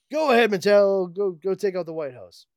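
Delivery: loud high villain order; villain command. Acted. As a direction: bark orders at the robot.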